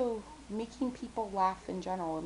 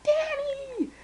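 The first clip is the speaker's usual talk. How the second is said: high, loud delighted shout of a name; excited call; 9 dB louder than usual. Retold or imitated. Friend Taylor greeting her online, loud happy call. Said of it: "Taylor's like".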